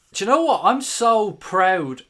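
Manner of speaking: high, loud burst of pride; excited outburst. Real, not acted. Bursts out proud of Origi.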